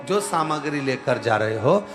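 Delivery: normal talking voice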